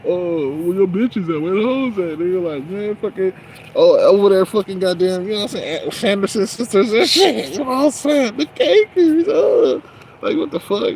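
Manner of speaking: high, loud, worked-up mockery; over-the-top mocking tirade; hyped mocking rant. Real, not acted.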